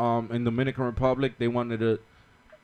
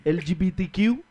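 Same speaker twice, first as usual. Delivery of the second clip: put-on Irish accent; 7 semitones higher than usual. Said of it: "are you still trying to do an Irish accent?".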